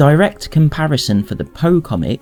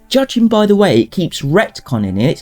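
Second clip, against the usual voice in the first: heated, rising, higher-pitched rant; angry rant; 6 semitones higher than usual. Real, not acted. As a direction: vent your frustration, building up. Building angry rant about retcons.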